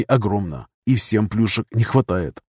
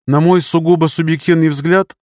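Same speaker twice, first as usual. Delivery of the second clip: put-on higher-pitched, pedantic old academic; tedious doddering professor; 6 semitones higher, 4 dB louder than usual. A narrator's reading. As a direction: lecture boringly like a senile professor. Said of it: "тоном старенького, выжившего из ума профессора".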